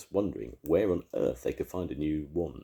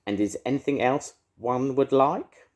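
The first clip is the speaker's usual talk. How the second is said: high, louder mocking witch voice; 10 semitones higher, 7 dB louder than usual. A narrator's reading. Edna sneering at the beast in a high witch voice.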